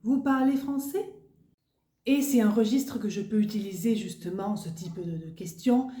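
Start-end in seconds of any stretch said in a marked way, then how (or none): 0.0-1.1 parlez français climbs up at the end; rising question tune. Shown as a demonstration. Teacher showing the informal question, rising at the end. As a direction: let français rise up high. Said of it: "il va y avoir une montée".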